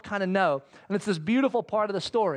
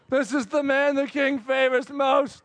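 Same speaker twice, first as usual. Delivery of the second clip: grudging proclamation; 4 semitones higher, 3 dB louder, slower than usual. Retold or imitated. Haman forced to proclaim.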